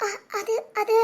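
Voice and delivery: soft, halting false starts; hesitant stammer. Acted. Wife cornered by husband's question, soft hesitant stammer. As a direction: falter quietly, unable to answer.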